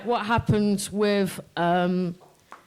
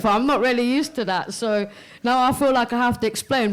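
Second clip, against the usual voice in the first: ordinary delivery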